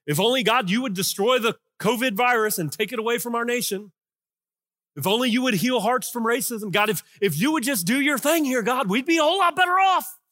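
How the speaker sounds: loud, pushy list of demands; voicing David's impatient prayer; complaining to God. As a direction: hammer out each "if only". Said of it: "he gets to tell God what he really thinks".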